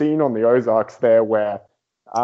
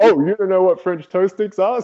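higher-pitched imitated astonishment; mock surprise; 6 semitones higher than usual. Wife to her husband who never tried the snack, mock surprise. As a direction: act out an amazed wife's question. Voicing his wife's astonished reply.

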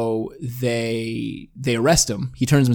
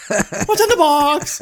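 high, loud, drawn-out wail; mock desperate movie-star cry; Brad Pitt; 5 dB louder, much slower than usual. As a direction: beg in hammed-up anguish. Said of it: "Brad Pitt's just like".

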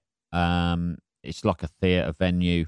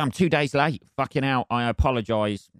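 ordinary talk, nothing marked

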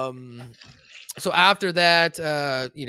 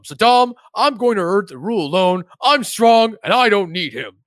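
higher, lightly hammed-up declaration; defiant Satan; 7 semitones higher, 5 dB louder than usual. Satan rehearsing his breakup speech, mock defiance. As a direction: work up mock resolve.

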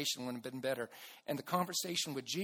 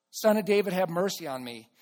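loud call, easing toward the end; bold cry; 3 semitones higher, 10 dB louder than usual. Retold or imitated. Blind man boldly crying out to Jesus despite the crowd, loud. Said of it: "bold enough to cry out in the crowd".

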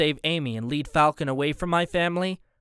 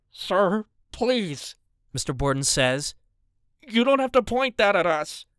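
put-on higher, begging older man; pleading husband; 6 semitones higher than usual. A narrator's reading. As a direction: plead nervously for mercy. Mister Borden begging the gunman.

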